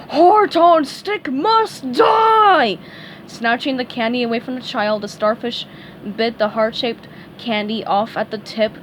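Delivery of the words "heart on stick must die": high, loud, very slow battle cry; monster shout. A narrator's reading. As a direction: bellow each word like a beast. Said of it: "cried out".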